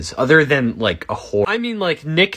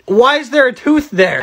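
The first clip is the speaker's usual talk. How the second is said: high, indignant outburst; angry; 10 semitones higher, 4 dB louder than usual. Real, not acted. Player fed up with a senseless emoji clue, angry. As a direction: demand it in exasperated disbelief. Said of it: "Sorry I got so angry there".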